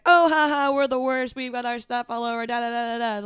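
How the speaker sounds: high, loud mock joviality, easing off; loud guy impression. Loud diner joking to the next table, mock boisterous. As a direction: imitate an overloud chummy stranger. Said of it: "they're kind of loud".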